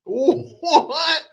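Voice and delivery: squeaky high disbelieving What; stunned high-pitched what; shocked What. Real, not acted. Shocked at report.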